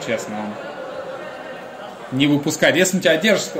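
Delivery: ordinary talk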